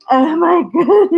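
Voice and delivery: high, loud burst of laughter; real laughing. Real, not acted. In tears over the mix-up, laughing helplessly. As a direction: crack up halfway through. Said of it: "I'm laughing".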